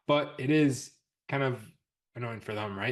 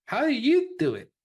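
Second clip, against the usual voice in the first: high, excited student question; eager student voice; 9 dB louder than usual. Retold or imitated. Mimicking eager students asking.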